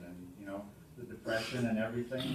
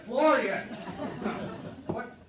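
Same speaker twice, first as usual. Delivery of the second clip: sharp high loud cry of a name; mother's scolding shout; 14 semitones higher, 9 dB louder, slower than usual. Retold or imitated. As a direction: yell the husband's name like a caught-out wife. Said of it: "she overhears again".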